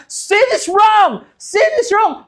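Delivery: a shouting preacher demonstrating empty yelling; mock shouting preacher. Shown as a demonstration. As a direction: bellow it like an empty fire-and-brimstone preacher. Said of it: "kahit pala sumigaw ako dito na".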